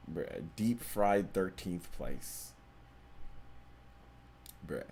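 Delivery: flat, slow "Deep Fried thirteenth Place", quietly amused; deadpan disbelief. Acted. Repeating the donor name deadpan.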